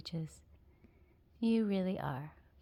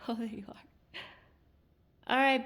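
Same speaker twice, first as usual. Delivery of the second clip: ordinary delivery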